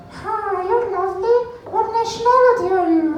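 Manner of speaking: put-on very high-pitched little old lady voice. Old lady greeting her seatmate on a plane, high-pitched. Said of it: "next to an old lady".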